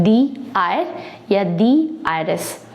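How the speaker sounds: दी आयर with a long "dee", slow; slow model of दी आयरस; the before vowel. Shown as a demonstration. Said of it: "इसको हम पढ़ते हैं".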